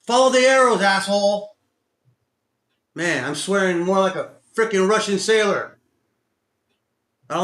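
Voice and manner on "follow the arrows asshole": high, loud, emphatic rant; real exasperated shouting; angry self-scolding. Real, not acted. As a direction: yell the rules at yourself in frustration. Angrily lecturing himself on rules.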